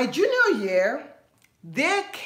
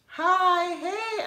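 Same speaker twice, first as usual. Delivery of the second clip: high, breezy greeting; imitating American guys; 9 semitones higher than usual.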